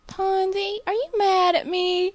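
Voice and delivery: put-on high, slow, plaintive old Southern grandmother; a hurt, lightly voiced grandma asking if she's mad; grandmother's voice. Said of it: "my grandmother will call back".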